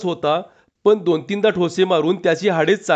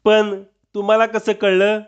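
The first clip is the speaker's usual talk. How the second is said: startled stammer, pitched up; पण तुम्हाला कसं कळलं? stuttered in a higher, scared voice; 5 semitones higher than usual. A narrator's reading. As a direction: caught out, stumble over the question. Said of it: "चाचरत चाचरत बोलला".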